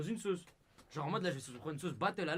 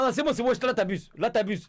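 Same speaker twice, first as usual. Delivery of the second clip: loud protest; 4 semitones higher, 8 dB louder than usual. Real, not acted. Proteste contre le gage.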